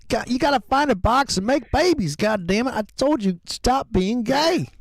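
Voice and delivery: high put-on voice of a fed-up father, slowing on the last words; high-pitched hammed-up father telling his boy off; mock angry dad. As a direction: scold your son like a cartoon dad. Mock father scolding son.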